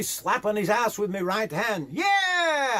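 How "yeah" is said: long, very high "yeah"; exhilarated rider's holler; top-of-the-voice yell. A narrator's reading. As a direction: urge the horse on with a yell. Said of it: "yelled at the top of my voice".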